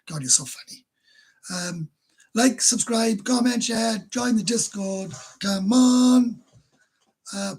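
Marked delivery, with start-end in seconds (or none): 2.3-6.4 loud, high call to action; rally the viewers; hyped-up shout of come on; urging shout. Real, not acted.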